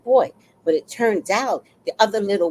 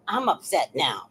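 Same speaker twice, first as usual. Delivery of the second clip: ordinary talk, nothing marked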